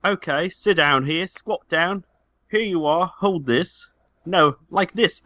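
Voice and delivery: normal talking voice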